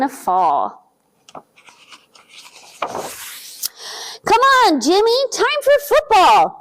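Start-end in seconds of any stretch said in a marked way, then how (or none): 2.8-6.6 eager Bobby. A narrator's reading. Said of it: "says Bobby".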